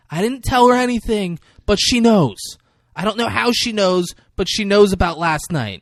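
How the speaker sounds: high, pleading explanation; frightened. Retold or imitated. Scared woman insisting to the angry man.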